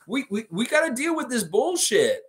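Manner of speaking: high, exasperated mock rant; freaking out. Lifeguard ranting at the Raptor and wizard, exasperated. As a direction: pitch it way up, fed-up lifeguard. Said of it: "He's freaking out".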